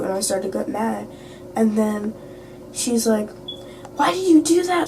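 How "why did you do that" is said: high, whiny mock accusation; mad accusation. Upset girl confronts [name], imitated mad accusation. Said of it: "she was mad at me".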